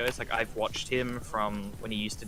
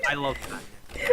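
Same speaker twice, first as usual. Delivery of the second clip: slow, spent whisper after laughing; breathless whisper; much slower than usual. Real, not acted.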